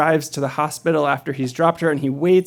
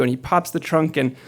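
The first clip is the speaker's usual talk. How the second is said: ordinary delivery, nothing marked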